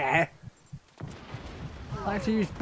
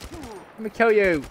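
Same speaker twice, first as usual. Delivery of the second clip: ordinary talk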